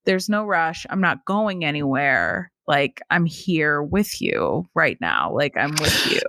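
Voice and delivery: low, gentle quoted sweetheart; tender reassurance. Retold or imitated. Voices hero reassuring heroine tenderly.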